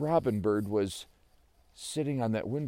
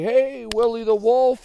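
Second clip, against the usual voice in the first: high, loud hail; calling out; 11 semitones higher, 11 dB louder than usual. Acted. Someone hails Willie the Wolf, calling loudly. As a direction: holler a greeting from far off.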